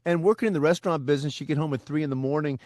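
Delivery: ordinary talk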